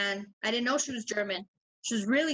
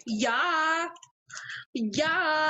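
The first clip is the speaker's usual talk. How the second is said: drawn-out, higher mock-German "ja"; mock German accent; 4 semitones higher than usual. Host answers the Jamaican bit in a mock German accent. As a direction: play at being German. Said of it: "German accent".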